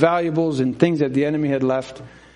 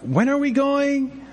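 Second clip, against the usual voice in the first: put-on whiny child; 10 semitones higher, slower than usual. Children whining to dad.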